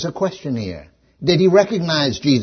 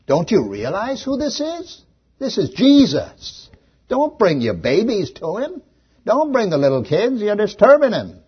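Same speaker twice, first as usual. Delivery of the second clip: scolding disciples; 9 semitones higher, 3 dB louder than usual. Acted. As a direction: play the disciples shooing the parents away, pitched up. Preacher voices rebuking disciples.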